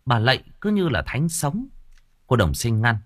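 usual speaking voice

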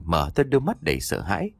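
ordinary delivery, nothing marked

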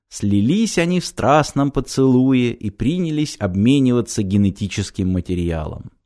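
ordinary delivery

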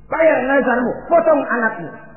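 high, loud acted order; stern command. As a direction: deliver it as a command from above. Dream voice commands the sacrifice. (perintah tegas, lantang)

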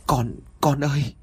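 a touch of a tearful mother's cry. Acted. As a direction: cry out to the sick child, half sobbing. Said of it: "thốt lên rồi òa khóc". (tiếng kêu nghẹn ngào của người mẹ)

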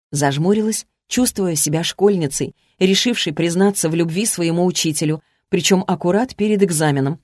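ordinary delivery

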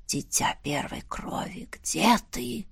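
ghostly whisper, barely audible; eerie whisper. A narrator's reading. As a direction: whisper it like a distant spirit. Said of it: "настолько тихим был этот шёпот". (призрачный шёпот)

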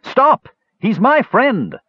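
high, urgent shouted command; shouting. A narrator's reading. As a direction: shout to halt a beating. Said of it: "when Skowar shouted".

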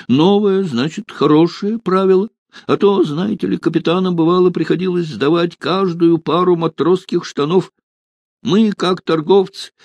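high-pitched, chatty justifying; high, fussy storytelling, easing off at the end; Mashin, anxious supply official. Acted. Voices Mashin grumbling in the story.

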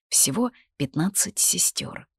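plain reading voice

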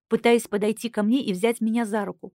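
ordinary delivery

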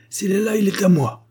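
high, loud possessive outburst; crying out. A narrator's reading. Treadle claiming the buried money for himself, crying out. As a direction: stake your claim forcefully. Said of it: "s'écrie-t-il".

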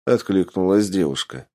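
ordinary delivery

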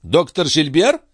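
high, surprised echo question; startled query. A narrator's reading. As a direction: ask back, taken aback. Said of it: "переспросила королева". (удивлённо переспросить)

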